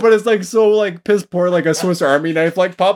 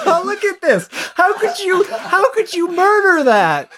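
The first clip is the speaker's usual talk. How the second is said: high laughing disbelief; 12 semitones higher than usual. Real, not acted. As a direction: crack up while asking. Laughing at photo.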